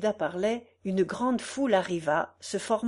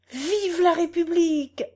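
acted rallying cry, pitched up; voice from the crowd; 10 semitones higher, 7 dB louder than usual. A narrator's reading. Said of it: "dit une voix".

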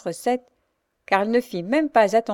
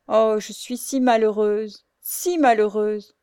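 slow, louder heartbroken lament; heart-rending cry; 2 semitones higher, 3 dB louder, slower than usual. Acted. Louise cries out her misery, heartbroken. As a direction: cry out in despair. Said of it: "un accent déchirant".

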